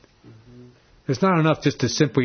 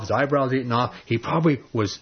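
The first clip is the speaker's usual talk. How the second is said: ordinary talk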